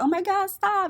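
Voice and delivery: high, loud panicked imitation of a screaming friend; mock scream. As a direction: yell it like you're breaking up a fight, half in fun. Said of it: "Joan was over there screaming".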